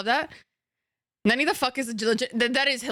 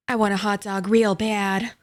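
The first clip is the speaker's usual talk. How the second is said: Jennifer Coolidge; 3 dB louder than usual. Acted. Streamer greeting the impression's namesake in chat, Jennifer Coolidge impression. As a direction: linger on the words, hammed-up. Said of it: "Jennifer Coolidge is in the chat".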